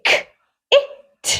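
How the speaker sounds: "ك-، إت" split into k, then short-i "it"; sounding out. Shown as a demonstration. Teacher helps pupils read the word kit, sounding it out. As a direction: break "ك-، إت" into separate phonics sounds. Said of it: "Let's try to read this word".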